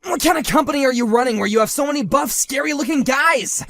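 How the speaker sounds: fast, loud, high alarm; rattled by the big men outside; alarmed outburst. Acted. Husband rattled by the buff workers, alarmed cry.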